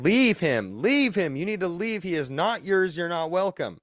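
friend's retold exorcism yell; mock commanding yell. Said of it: "she's fucking yelling at this thing".

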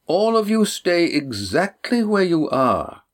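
slow, imperious grandmother voice; an elder used to being obeyed; commanding old woman. A narrator's reading. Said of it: "but nonetheless commanding".

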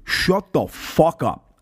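slow, drawn-out New York telling-off; New York heckler. Retold or imitated. Fellow New Yorker tells heckler off, retold in slow New York style. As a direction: lean on each word like an irritated New Yorker. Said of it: "in the most New York way".

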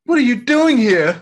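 alarmed at his mother's visit; startled Thor. Retold or imitated. Thor voiced in a retold scene.

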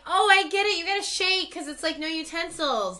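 put-on teasing girl voice. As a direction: quip it like a cheeky stranger. Retells a girl's teasing joke.

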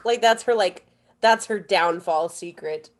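usual speaking voice

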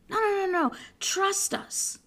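hushed, high-pitched false assurance; government reassurance. Acted. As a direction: placate them like a slippery official. Mimics government reassuring the public.

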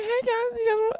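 very high, thin mock voice; squeaky voice. Greeting the guys in a squeaky mock voice. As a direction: squeak it like a little kid.

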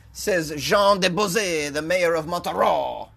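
hammed-up French accent, drawn out slowly. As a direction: say the French names with a put-on French accent. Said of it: "Was that a good French accent?".

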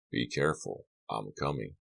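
low, soft voice dropping away on the last words; a quiet echo of his brother's warning from the phone; remembered phone voice. Retold or imitated. Carrie recalling brother Donald's phone warning, hushed and low. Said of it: "Donald's voice on the phone came back to him".

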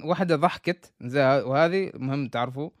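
ordinary delivery

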